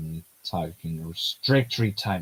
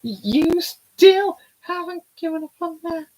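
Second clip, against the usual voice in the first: put-on bright girlish character voice, much higher; high heroine voice; 8 dB louder, slower than usual. Voicing the heroine asking where the trip goes, high girlish voice. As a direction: play the young heroine, light and high.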